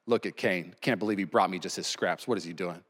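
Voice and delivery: mock an irritated deity tapping his toe; impatient God. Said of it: "just tapping his toe".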